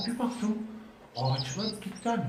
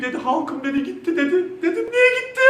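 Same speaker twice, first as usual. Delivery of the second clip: rising into high laughter; losing composure, giggling; laughing; 13 semitones higher, 8 dB louder than usual. Real, not acted. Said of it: "yine toparladım". (gülmeye boğularak)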